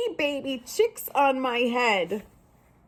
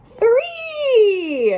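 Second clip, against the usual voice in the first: high, loud "Three!" dramatic count; gleeful shout of three; excited reveal; 12 semitones higher, 6 dB louder than usual. Acted. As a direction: announce the third egg with a burst. Counting eggs, big reveal.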